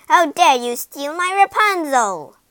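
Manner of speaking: high, loud accusation; outraged witch cry. A narrator's reading. Witch catches man stealing her plant, outraged cry. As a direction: confront a thief in fury. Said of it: "the witch cried".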